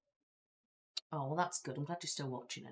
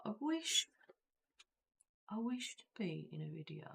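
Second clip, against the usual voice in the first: whispering; 5 semitones higher, 3 dB quieter than usual. Shown as a demonstration. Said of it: "reading viewer message aloud".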